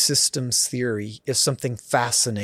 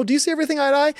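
light surface question; 13 semitones higher, much faster than usual. Shown as a demonstration. Counselor asking a couple the shallow question, high and breezy. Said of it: "a surface level".

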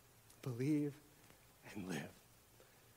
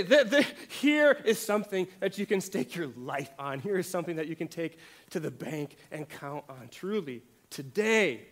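higher and louder, hammering each truly and today; fervent preaching; 4 semitones higher, 9 dB louder than usual. Acted. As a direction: insist it like a promise to stake your life on. Preaching Jesus's promise.

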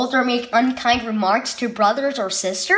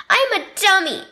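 whimpering; 8 semitones higher, faster than usual. Acted. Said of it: "Colton whimpered".

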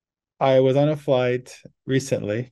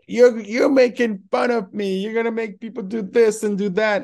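mocking a drunk passenger's high-pitched outrage; loud upset lady; 11 semitones higher, 5 dB louder than usual. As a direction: imitate her as an indignant passenger. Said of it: "very loud".

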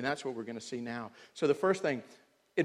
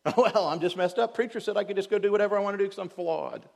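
higher, louder, fast mocking whine; mock excuse; 7 semitones higher, 7 dB louder, faster than usual. Preacher mocking a careless believer's excuse, higher and louder. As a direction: lampoon a lazy excuse-maker, quick and shrill-free.

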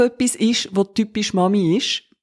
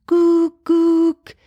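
much higher, louder mock chime; pretend hotline chime; 10 semitones higher, 4 dB louder than usual. Mimicking a hotline jingle, high and loud. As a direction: chirp it like a hold jingle. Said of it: "Weisst, so".

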